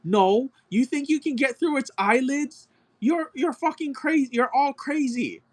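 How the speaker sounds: high whiny mock voice of a know-it-all; ridiculing an arrogant zookeeper in a high whine. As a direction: squeak it out like a smug zookeeper. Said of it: "He was like".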